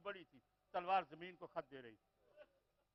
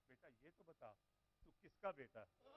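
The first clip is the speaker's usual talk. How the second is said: hushed question; 4 semitones lower, 12 dB quieter than usual. Acted. Imam gently questions orphan child.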